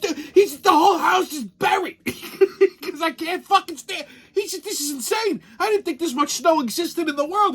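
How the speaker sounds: high-pitched despairing whine; mock the panicked Floridian; distraught buddy. Buddy describes the buried house in a high whine.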